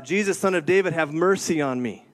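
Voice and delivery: ordinary delivery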